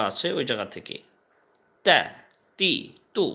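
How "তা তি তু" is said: teacher modeling তা তি তু; model syllables. Shown as a demonstration. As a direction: clear teaching demo of ta ti tu. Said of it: "জি, বলেন তো একটু".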